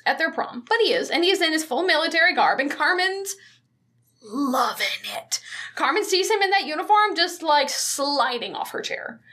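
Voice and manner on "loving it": swooning whisper. Retold or imitated. Narrating Carmen swooning over uniform.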